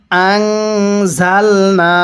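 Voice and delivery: slow, drawn-out tajweed chanting; slow Quran recitation. Shown as a demonstration. Teacher demonstrating recitation to students, slow chant. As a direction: recite each syllable long and measured. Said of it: "কিভাবে পড়তে হবে?".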